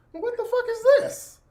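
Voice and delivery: high, loud outraged squeal; shocked outburst. Retold or imitated. Uncle spotting charges, shocked high squeal. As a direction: shriek at the strange charges.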